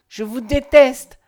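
loud, high, slow declaration; anguished, drawn-out outburst; pained cry. A narrator's reading. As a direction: hurl it out slowly, in pain. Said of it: "répéta-t-elle douloureusement".

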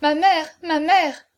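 high, loud, drawn-out plea; crying out. A narrator's reading. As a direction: cry out slowly to a mother in distress. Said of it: "s'écria Aurore". (s'écrier, suppliante)

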